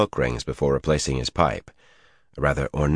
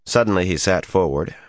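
ordinary delivery, nothing marked

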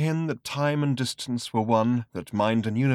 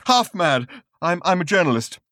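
agitated; 7 semitones higher, 5 dB louder than usual. Acted. Raving man.

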